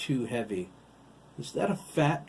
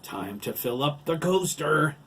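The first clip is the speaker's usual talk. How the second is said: raised, rising villainous call; giant's yell; 6 semitones higher, 6 dB louder than usual. A narrator's reading.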